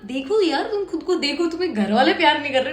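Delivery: normal talking voice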